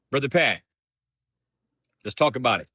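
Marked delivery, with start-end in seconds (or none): none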